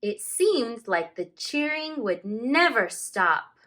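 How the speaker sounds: slow, expressive read-aloud with long page-turn pauses; story narration. A narrator's reading. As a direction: read it like a teacher sharing a picture book with the class. Teacher reads picture book aloud.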